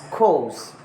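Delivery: "cause" with a long vowel and no R; British "course", R replaced by the vowel; British dropped R. Shown as a demonstration. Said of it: "would be somewhat like".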